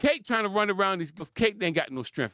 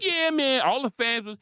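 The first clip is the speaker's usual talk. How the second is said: higher, excited fan chant; mocking hyped fans; 8 semitones higher, slower than usual. Commentator mocking fans cheering Kate, higher and hyped. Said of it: "Everybody like".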